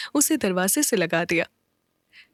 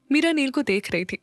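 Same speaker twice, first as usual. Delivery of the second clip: ordinary delivery, nothing marked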